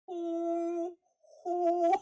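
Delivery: high, sustained level hoo; held owl hoot. Shown as a demonstration. Teacher showing how to hold the hoo, held owl hoot. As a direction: stretch the hoot into a tone. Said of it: "Hold the hoo".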